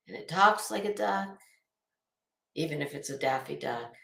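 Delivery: ordinary talk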